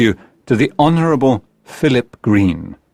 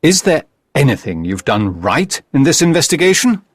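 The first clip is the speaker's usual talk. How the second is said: lightly voiced detective, pitched up; a touch of scolding for a bungling colleague; mild reproach; 5 semitones higher than usual. Holmes scolds the narrator.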